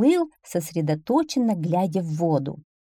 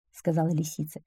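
ordinary delivery, nothing marked

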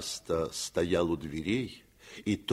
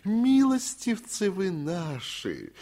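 higher, slow sing-song of a tipsy bailiff; sing-song fawning; 11 semitones higher, slower than usual. A narrator's reading. Bailiff croons to the master in a sing-song. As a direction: croon it out, all tender servility. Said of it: "заговорил он нараспев и с таким умилением".

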